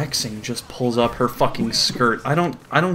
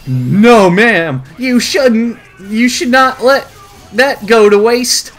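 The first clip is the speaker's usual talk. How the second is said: high, loud mock protest; comic character voice, pitched up; over-eager suitor; 8 semitones higher, 6 dB louder, slower than usual. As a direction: push it high and hammed-up. Voicing Gil's eager reply.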